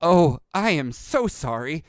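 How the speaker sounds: slow, drawn-out flustered apology; mild panic. Acted. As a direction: apologize anxiously, then fumble for words.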